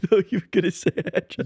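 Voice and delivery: giggling through the words; laughing. Real, not acted. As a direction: laugh it out, fast and high. Laughing at her own joke.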